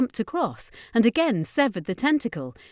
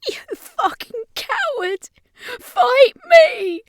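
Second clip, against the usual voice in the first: much higher, crying scream, getting louder; panicked scream; 10 dB louder, slower than usual. Acted. Ashley trapped in the dark, panicked crying scream. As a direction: scream through tears, terrified. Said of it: "she screamed in panic".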